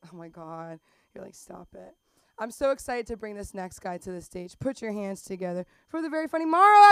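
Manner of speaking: ordinary talk, nothing marked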